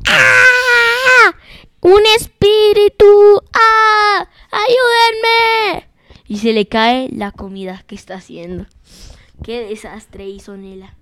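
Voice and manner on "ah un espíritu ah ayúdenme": high, loud terrified cries for help; frightened screaming. Acted. Mom in the kitchen, startled by a ghost: screaming in terror. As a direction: shriek as if a ghost burst into the kitchen. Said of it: "ella se asustó y dijo".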